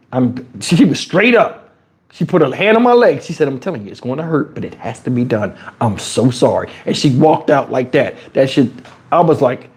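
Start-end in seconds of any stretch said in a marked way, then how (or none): 3.5-6.7 soft, quick and sorry; sincere apology. Retold or imitated. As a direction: gently break the bad news. Nurse apologizing before painful extraction.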